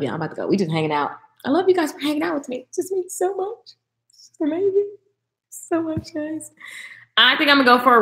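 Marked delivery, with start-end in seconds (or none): 1.4-6.5 high, soft and gushing; softer, higher heartfelt gush; gushing thanks. Real, not acted. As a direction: swoon with gratitude. Thanking viewers for hanging.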